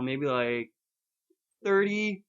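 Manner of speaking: usual speaking voice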